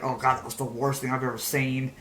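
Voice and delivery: low, soft aside; unimpressed parents. Acted. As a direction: grumble it under your breath as an appalled parent. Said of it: "The parents are like".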